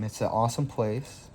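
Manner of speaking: normal talking voice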